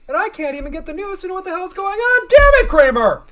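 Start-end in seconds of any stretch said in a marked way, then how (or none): 2.3-3.2 loud, exasperated yell; angry shout. Acted. Jerry loses patience over his broken TV, shouting. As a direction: holler it in frustration. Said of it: "As he shouts".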